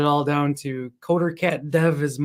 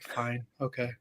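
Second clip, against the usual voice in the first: much softer, low-key mock concession; retold reluctant, hushed agreement; resigned giving in; 3 semitones lower, 11 dB quieter than usual. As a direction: give in quietly, as if overruled. Giving in to wife's objection.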